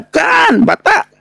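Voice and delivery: comic high-pitched denial; high insistent shout. Real, not acted. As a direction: yelp it way up high. Insisting against audience member.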